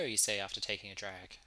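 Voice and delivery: ordinary talk, nothing marked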